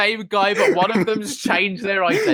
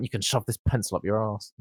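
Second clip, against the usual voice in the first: low, much softer aside; half-swallowed rude mutter; muttered insult; 8 semitones lower, 7 dB quieter than usual. Retold or imitated. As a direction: mutter it under your breath, turned away. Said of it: "I turned away from her and I went".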